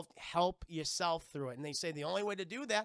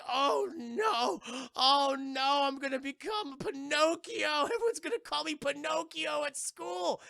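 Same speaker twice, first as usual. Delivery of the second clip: high, loud mock-panicked wail; freaking out; 9 semitones higher, 5 dB louder, slower than usual. Character turning into a tree, hammed-up panicked lament. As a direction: wail it like a kid dreading ridicule. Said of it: "he starts freaking out".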